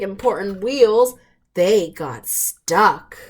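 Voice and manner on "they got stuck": ordinary delivery, nothing marked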